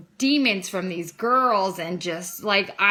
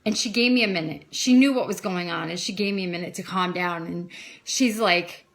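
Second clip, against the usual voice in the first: ordinary delivery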